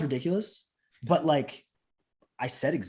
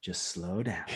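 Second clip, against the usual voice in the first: low, soft, drawn-down voice; hushed and low, easing them down; calming coach; 8 semitones lower, 10 dB quieter, much slower than usual. Retold or imitated. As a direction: gently rein in someone rushing. Coach calming a too-fast trainee.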